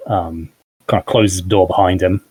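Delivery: ordinary talk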